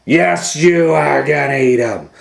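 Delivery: loud, commanding. A narrator's reading. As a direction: order it. Gorilla forces.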